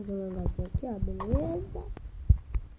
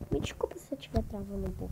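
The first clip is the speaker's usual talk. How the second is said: whispering; 4 semitones lower, 8 dB quieter, faster than usual. Real, not acted. Apologizing while lagging.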